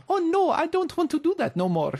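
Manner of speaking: high, hammed-up French accent; bad French accent. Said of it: "'Cause she's got a really bad, uh, French accent, just like mine".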